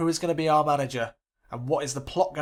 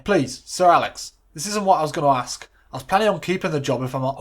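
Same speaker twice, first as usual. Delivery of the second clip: protesting assistant; 2 semitones higher, 7 dB louder than usual. Acted.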